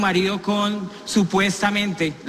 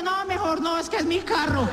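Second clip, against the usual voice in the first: high, softer mock wife voice; wife declining; 8 semitones higher, 3 dB quieter than usual. Wife refusing to smash her own car, high mock voice. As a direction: back off, it's your own car. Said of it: "la señora".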